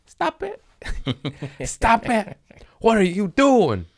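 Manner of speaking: very high mock squeal; squealing stop it. Teasing the world, high mock squeal. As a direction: shriek "Stop it." in mock protest.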